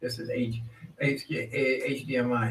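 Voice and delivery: talking between sips of juice; words around a sip of drink; while sipping a drink. Real, not acted. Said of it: "I'm drinking this".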